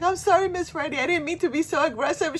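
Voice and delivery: high, loud mock apology; retold apology. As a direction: plead it in an exaggerated high voice. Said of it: "So I apologized to her".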